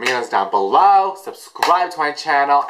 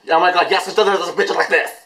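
fast, high excited babble; hyped-up quick gush; excited cheering; 6 semitones higher, faster than usual. Real, not acted. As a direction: rush it out high, egging them on. Excited reaction to the video.

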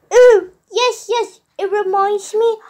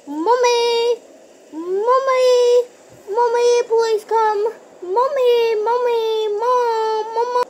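crying for mommy; 6 semitones higher than usual. Acted. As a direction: wail for Mommy to come. Child crying out for mother.

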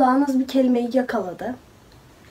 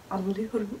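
soft unvoiced whisper of a single word; whispered reply; 3 semitones lower, 12 dB quieter than usual. Teen answering her mother through the door, whispered. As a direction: breathe it out without voice, a bit sheepish.

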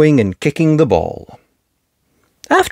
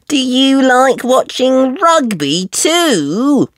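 put-on high, slow little girl voice; a small piggy complaining; Peppa piglet voice; 12 semitones higher, much slower than usual. Peppa complaining to friends about the noisy daddies.

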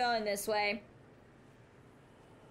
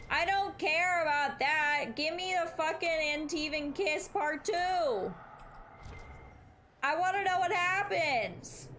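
frustrated outburst; 6 semitones higher than usual. Real, not acted. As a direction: wail for the sequel in exasperation. Demands the romance sequel.

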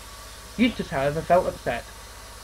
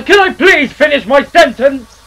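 louder, slow, exasperated; irritated snap; 8 semitones higher, 11 dB louder, slower than usual. Acted. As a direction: bite it off, fed up. Eustace snaps at interrupters.

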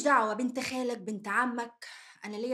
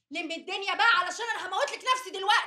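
higher, loud threat; desperate shout; 7 semitones higher, 4 dB louder, faster than usual. Acted. Wife threatening husband amid the brawl, desperate shout. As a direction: yell it at breaking point.

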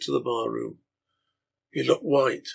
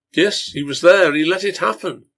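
higher, louder outraged shout; indignant shout; 6 semitones higher, 8 dB louder than usual. Acted. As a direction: hurl the accusation. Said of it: "several shouted with indignation".

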